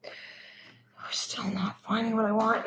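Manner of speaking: slow whispered mutter under her breath; whisper to self. Real, not acted. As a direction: mutter it slowly, barely voiced, just for yourself. Muttering to herself while searching.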